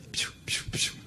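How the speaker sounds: unvoiced hissed psst-like piu to call a waiter; imitated hissing call. Friend hailing a fussy European waiter, soft hissed piu call. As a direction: hiss piu piu piu softly through the teeth.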